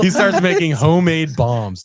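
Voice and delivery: high, loud repeat through laughter; cracking up while repeating it; laughing. Real, not acted. Podcaster repeats the absurd twist to co-host, laughing.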